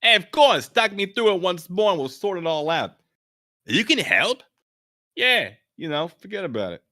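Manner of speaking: loud, high New York wiseguy; Brooklyn accent. Acted. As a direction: reassure him, Brooklyn style. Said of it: "Josh's voice is gonna be, Brooklyn".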